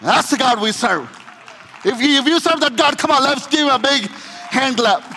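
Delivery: loud, high, shouted sermon climax; roaring preacher over music; preaching at full force. Acted. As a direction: preach the father's welcome with everything you have. Preacher climbs to a shouted peak.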